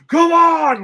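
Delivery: high, loud retold yell; imitated scream. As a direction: yell it out, mimicking a screaming man. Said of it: "like, screaming".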